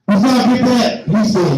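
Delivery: usual speaking voice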